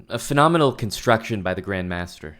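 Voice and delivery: ordinary talk, nothing marked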